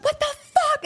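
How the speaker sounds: imitating a very high-pitched outburst; retold freaking out. Sister learning of the prank, imitated high freak-out. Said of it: "And she's freaking out at me".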